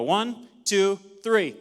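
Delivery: loud, rallying count to three; loud countdown. Shown as a demonstration. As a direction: call out the count loudly to pump up the crowd. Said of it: "on the count of three, here we go".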